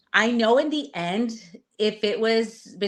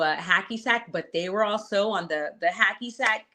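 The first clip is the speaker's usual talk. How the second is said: normal talking voice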